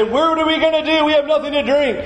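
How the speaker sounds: high, whining mock complaint; whiny complainer. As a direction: whimper like spoiled thirsty people. Voicing thirsty complaining Israelites.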